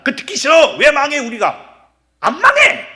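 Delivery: high, loud indignant retort; defiant protest. Acted. People refusing Isaiah's warning, loud defiant protest. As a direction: rise to a mock shout of refusal. Acted people rejecting the prophet.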